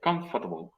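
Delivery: "Comfortable" said COM-fuh-tuh-bul, low; four syllables. Shown as a demonstration. Teacher repeating the alternative for students, comfortable in four syllables. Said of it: "también puede tener cuatro".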